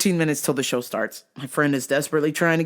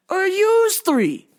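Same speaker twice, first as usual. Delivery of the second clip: mock indignant rant; 14 semitones higher, much slower than usual. Ranting at the guards.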